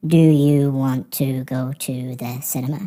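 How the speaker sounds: "Do you want to go to the cinema?" slowly, each word separate; slow, word-by-word "want to go", no linking; learner's unconnected speech. Shown as a demonstration. Teacher imitating someone learning English, slow and unconnected. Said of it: "someone learning English might say".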